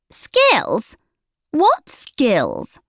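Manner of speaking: high, scoffing disbelief; mocking. A narrator's reading. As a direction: sneer at the very idea. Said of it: "Camille's mocking tones".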